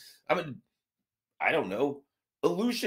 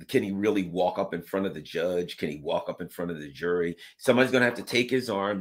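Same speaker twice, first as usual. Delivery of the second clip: usual speaking voice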